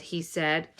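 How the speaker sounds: ordinary delivery, nothing marked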